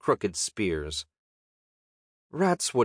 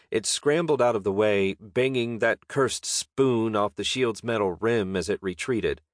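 ordinary delivery

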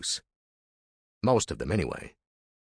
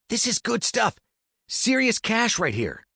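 higher, pushy younger brother; Andy's voice; 14 semitones higher than usual. Acted. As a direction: urge him on, high and eager. Andy voiced.

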